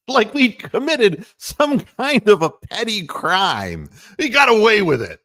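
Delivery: loud, high mock indignation; loud mocking crow; mock outrage. Real, not acted. Mock guilty, then loud.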